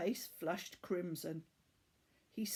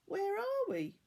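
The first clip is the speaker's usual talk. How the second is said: Laura asking; 11 semitones higher than usual. Acted. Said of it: "she asked".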